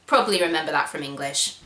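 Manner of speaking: ordinary talk, nothing marked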